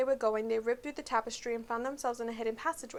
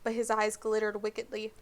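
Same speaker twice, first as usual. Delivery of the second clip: plain reading voice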